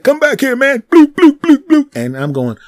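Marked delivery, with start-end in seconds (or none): 0.0-0.8 high, loud call after someone; yelling after him. Retold or imitated. Bottle guy shouting after the man walking away, high and loud. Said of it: "the guy's like". 0.9-2.0 dull bloops. Retold or imitated. Bottle hits wall.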